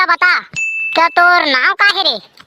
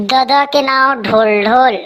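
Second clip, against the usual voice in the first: low, slow repeat while writing it down; slow dictating repeat; 6 semitones lower than usual. Acted. Census man repeating slowly as he writes it down. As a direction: spell it out slowly for the form.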